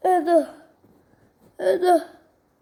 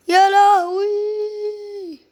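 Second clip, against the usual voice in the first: drawn-out high, loud cry of alarm; يا لهوي ممدودة بصوت عالي مصدوم; shocked wail; 6 semitones higher, 4 dB louder, much slower than usual. Real, not acted. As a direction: stretch it out in comic horror. Sudden shock in the game.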